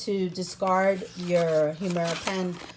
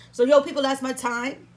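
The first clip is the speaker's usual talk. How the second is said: higher, louder call to the viewers; hyped sign-off; 7 semitones higher, 6 dB louder than usual. Real, not acted. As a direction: shout out to the audience to wrap up.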